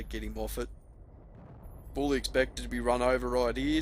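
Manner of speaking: ordinary talk, nothing marked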